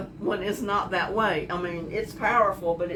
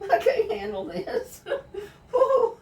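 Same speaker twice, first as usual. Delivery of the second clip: much higher, cracking with laughter; laugh-crying; 13 semitones higher than usual. Real, not acted. Cook overwhelmed by onion fumes, high laughing squeal. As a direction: squeal through tears and laughter. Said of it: "I'm crying".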